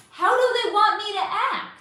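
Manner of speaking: high, loud, exasperated retold plea; exasperated outcry. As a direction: voice the mother's outrage. Mother asking in exasperation.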